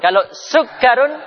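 "sugarun" with the r doubled straight away and no lengthening; teacher contrasting "sugarun" with "Harun"; direct doubling. Shown as a demonstration. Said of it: "langsung tasdid ya".

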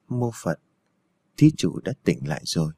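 low, soft monk's voice; lightly voiced, calm old monk. A narrator's reading. Old monk soothing the patient.

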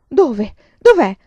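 loud, high, startled cry; alarmed questions. A narrator's reading. Wife alarmed at a mouse. (allarmata, quasi gridando)

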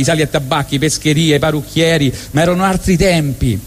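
normal talking voice

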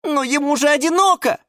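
tiny, very high plea; timid squeak. A narrator's reading. Violet timidly defends lonely Валера, high squeak. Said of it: "робко пискнула фиалка Наталка".

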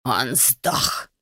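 low, soft wheeze of a strangled man; gasping it out while being choked; choked rasp. A narrator's reading. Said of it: "просипел". (сипло, задыхаясь)

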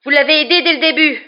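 high, loud accusation; angry cry. A narrator's reading. Boy accusing professor of helping the fugitive, shouting. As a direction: hurl the charge at full voice. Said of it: "s'écria Harry".

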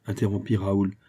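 usual speaking voice

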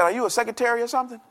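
lightly imitated bishop, high and quick; a quick, high-pitched mimicked rebuke; Bishop Oyedepo. Bishop chiding people who pray holding phones, light imitation. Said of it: "That's how he talks".

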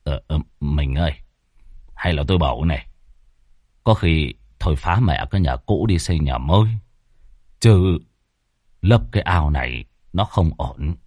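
low, hesitant husband voice; flustered husband. Acted. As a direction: sheepishly plead with your wife. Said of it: "lúng túng nhìn vợ". (giọng chồng trầm, lúng túng)

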